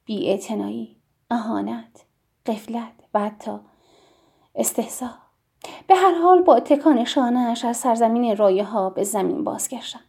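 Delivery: ordinary delivery, nothing marked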